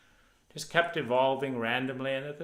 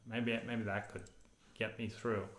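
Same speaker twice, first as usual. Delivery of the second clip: normal talking voice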